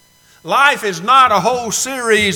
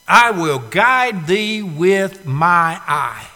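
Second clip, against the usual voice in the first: drawn out slowly, each word weighed; slow it right down and let every word land; the verse spelled out slowly for the congregation; slow, emphatic verse repeat; much slower than usual. Shown as a demonstration. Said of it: "you really expect the Lord to do that".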